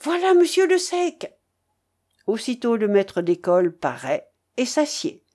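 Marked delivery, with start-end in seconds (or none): 0.0-1.3 high excited announcing cry; cri essoufflé. A narrator's reading. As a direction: burst in calling out the news. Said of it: "entra en criant". (cri d'annonce, voix haut perchée)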